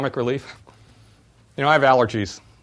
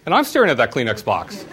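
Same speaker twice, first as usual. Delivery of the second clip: normal talking voice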